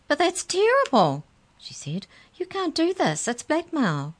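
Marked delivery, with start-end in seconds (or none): 0.1-1.2 high, loud cry of alarm; frightened squeal of protest; trembling dismay. A narrator's reading. Mallory protesting to her captors, trembling dismay. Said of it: "began to tremble". 2.4-4.1 high-pitched frightened protest; trembling dismay. A narrator's reading. Mallory accusing her captors of blackmail, trembling dismay. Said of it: "began to tremble".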